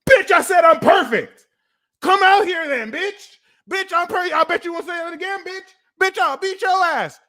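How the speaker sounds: high-pitched, hammed-up fighting shriek; aggressive mock nurturing. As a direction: pick a fight in a shrill mock rage. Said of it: "This is how you wanna nurture it".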